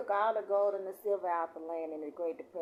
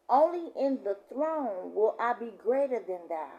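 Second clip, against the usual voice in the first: scripture reading; 4 semitones higher, 7 dB louder than usual. Real, not acted. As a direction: proclaim the verse to the congregation. Reads scripture aloud.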